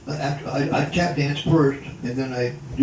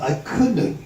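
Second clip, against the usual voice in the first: normal talking voice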